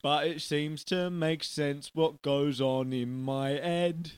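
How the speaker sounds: drawn-out silly chimney-sweep; Bert. Acted. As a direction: stretch each word out hammily. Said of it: "My name is Bert".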